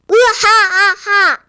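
slow, booming evil laugh; booming giant laugh. Acted. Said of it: "큰 소리로 말하는 거예요".

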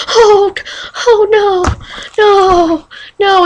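mock panicked whimper. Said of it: "and I'm over there".

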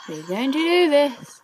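determined rallying cry. Real, not acted. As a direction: push the pitch up, rallying yourself. Psyching up for another go.

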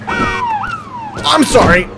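very high, slow stretched sorry; comic high drawn-out apology; squeaky drawn sorry. Pretend.

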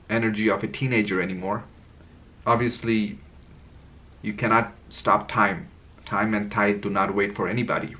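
normal talking voice

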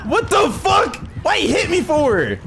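high, indignant yell; outraged shout. Real, not acted. Player hit by attacker, high outraged shout. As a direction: cry out in offended shock.

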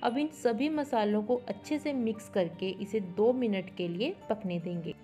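normal talking voice

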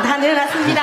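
high, loud, obedient answer; emphatic declaration. Real, not acted. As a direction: declare it out loud as the teacher told you. Student declares it as told.

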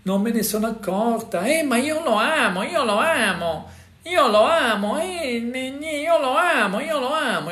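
high, pleading lovesick voice, repeating itself; a high, hammed-up imitation of someone blindly in love; mock lovestruck victim. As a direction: play the besotted partner who keeps insisting. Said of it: "e tu dici".

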